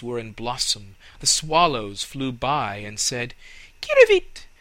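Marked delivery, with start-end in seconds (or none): 3.8-4.5 very high, loud bird call; chirping swallow. Acted.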